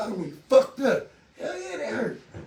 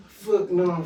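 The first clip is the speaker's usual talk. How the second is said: usual speaking voice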